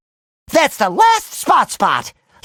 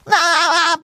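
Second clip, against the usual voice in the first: high bubbly cartoon giggle; short rippling chuckle; goofy laugh; 6 semitones higher than usual.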